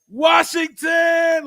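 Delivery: high, long drawn-out yelled name; mock yell. Quoting a rapper's line, high drawn-out yell. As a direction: stretch it out like a rowdy fan. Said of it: "with the etiquette of V A yelling".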